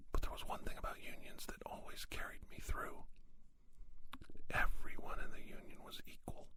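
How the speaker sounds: sustained soft, breath-only whisper; hushed whispered monologue. Acted. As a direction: whisper it steadily, with pauses between phrases. Long whispered monologue on bosses.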